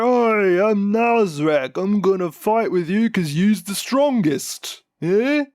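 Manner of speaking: loud, high-pitched Cockney orc bellow. Retold or imitated. Podcaster voicing Nazrek the orc for co-host, loud orc bellow. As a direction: brag like a brawling orc picking a boss. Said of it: "I love the orc voice".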